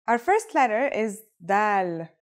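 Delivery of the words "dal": "dal" begun like an English D; slow, clear D at the start of "dal"; English D sound. Shown as a demonstration. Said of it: "just like the English D".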